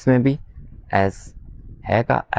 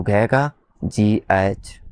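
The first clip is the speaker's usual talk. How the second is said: slow spelling; much slower than usual. Shown as a demonstration. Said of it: "slow spelling demo".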